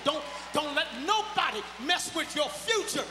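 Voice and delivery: loud preacher's exhortation; preaching at full force. Acted. Preacher warning the church about the devil, preaching hard. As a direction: drive it home to the witnesses.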